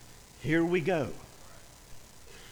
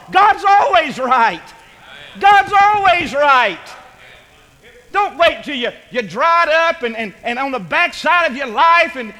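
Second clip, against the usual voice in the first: loud, high-pitched sermon climax; full-force preaching; 9 semitones higher, 9 dB louder than usual. Real, not acted. Preacher urging the congregation not to wait, full-force preaching. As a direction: preach it out hard, stretching the big lines.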